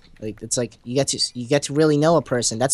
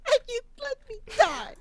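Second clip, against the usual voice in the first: melodramatic betrayal; 12 semitones higher than usual. Acted. Accusing Sydney of letting him die, soap-opera betrayal. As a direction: play the betrayed lover.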